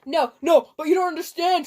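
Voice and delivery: loud, hammed-up begging; mock-plead to be heard out. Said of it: "Draco pleaded".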